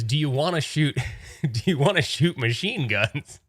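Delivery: a hint of a keen desert local; Arizonan tour guy. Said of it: "he didn't say it like that".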